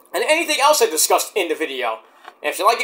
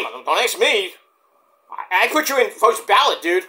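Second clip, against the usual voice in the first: normal talking voice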